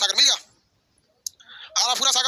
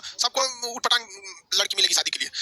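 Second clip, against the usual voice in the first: mock threat; 3 semitones lower, 3 dB quieter than usual. Teasing threat to students.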